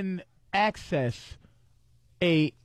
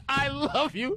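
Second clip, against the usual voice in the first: higher, sing-song "I love you"; mistaken drunk confession; 7 semitones higher, slower than usual. Retold or imitated. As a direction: blurt "I love you" up high like a tipsy slip. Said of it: "it was a mistaken".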